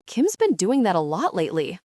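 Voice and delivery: plain reading voice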